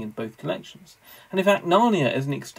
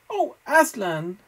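higher, slow, despairing cry; the children, told they can never return, in despair; 9 semitones higher, slower than usual. Acted. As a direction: let the hope drain out of the name. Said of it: "in despairing voices".

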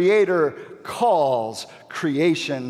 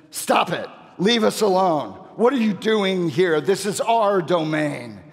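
raised, higher, punchy objection; indignant demon's protest; 4 semitones higher, 3 dB louder than usual. Acted. Demon tells Jesus to back off, indignant. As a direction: order the intruder off your turf. Translates the demon's angry challenge.

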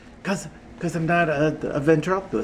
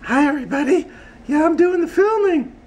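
very high puppet voice; squeaky, chirpy falsetto character; Audrey; 11 semitones higher than usual. Acted. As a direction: pipe up high as a little puppet. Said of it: "Here's my Audrey".

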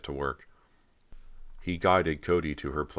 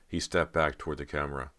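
ordinary delivery

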